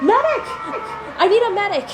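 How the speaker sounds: loud, high, urgent yell; desperate shout for a medic. Acted. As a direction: call across the chaos for help. Calling for help after blast.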